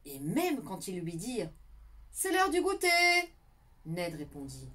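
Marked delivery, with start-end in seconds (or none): 2.1-2.9 a lightly sung-out call, higher; a touch of cheerful parent. Acted. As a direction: call the child to snack. Parents calling snack time.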